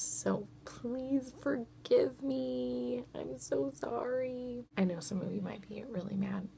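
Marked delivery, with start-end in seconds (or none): 4.8-6.5 guilty whisper; hushed apology. Real, not acted. Apologising to viewers.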